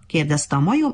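plain reading voice

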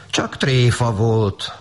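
slow, high, drawn-out apology; acted embarrassed plea; sheepish excuse. A narrator's reading. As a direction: excuse yourself shamefacedly, stretching each word. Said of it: "mentegetőztek szégyenkezve".

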